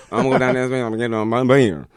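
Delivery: fast, run-together Southern mumble; slurred rapid Texan gibberish; Boomhauer. Retold or imitated. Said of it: "even the Boomhauser thing".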